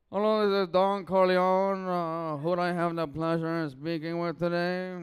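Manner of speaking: hammed-up mafia don selling internet bundles; Don Corleone. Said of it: "this is my impression of Don Corleone".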